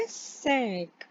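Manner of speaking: "सैंक" softly, nasal, not "सिंक"; nasal cinq. Shown as a demonstration. Teacher softly modelling nasal cinq. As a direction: say "सैंक" through the nose. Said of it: "सिंक नहीं बोलना है".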